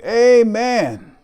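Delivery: high, loud, drawn-out Amen; shouting. Acted. Preacher voicing the Israelites' response to condemned nations, shouting. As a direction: shout it like a whole crowd agreeing. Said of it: "we can almost hear the Israelites shouting".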